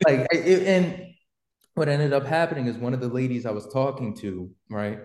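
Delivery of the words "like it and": usual speaking voice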